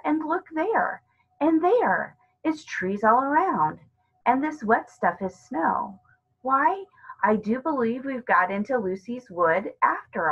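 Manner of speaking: lightly voiced boy realising they're in the wood; Peter, amazed. Peter voiced, discovering snow.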